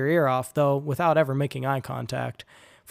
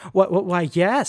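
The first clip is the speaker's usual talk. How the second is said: higher, louder panicked stammer; stuttering; 5 semitones higher, 7 dB louder than usual. A narrator's reading. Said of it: "stuttered Sam".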